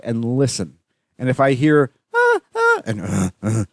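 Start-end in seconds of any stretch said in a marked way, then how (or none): 2.1-2.8 very high-pitched mock moaning; mock pleasure moans. Imitating a couple overheard in the park, pleasure moans. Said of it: "like, two positive sounds". 3.0-3.6 low mock grunting; mock pleasure grunts. Imitating the second of two people having pleasure, low grunts. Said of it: "like, two positive sounds".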